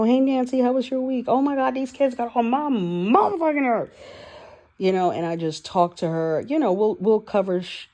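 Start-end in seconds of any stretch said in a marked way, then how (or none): 0.0-3.9 high-pitched, chatty imitation of griping; mock venting. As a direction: act out breezy small talk turning into exasperated complaint. Acting out chatty therapy venting.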